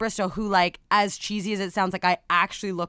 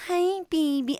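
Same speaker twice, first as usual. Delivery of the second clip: put-on baby voice; 6 semitones higher than usual. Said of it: "people are like".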